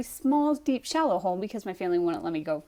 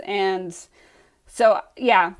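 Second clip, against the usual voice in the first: ordinary talk, nothing marked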